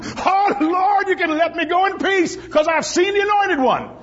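put-on high, shouted old prophet's praise; preacher's shout. Old Simeon preaches in the temple, shouting high. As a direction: cry it out to the Lord, high. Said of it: "He started shouting".

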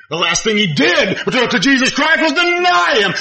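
high, fervent sermon voice; impassioned preaching. Acted. Preacher telling of Peter's denial, impassioned and high. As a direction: lift the story of Peter.

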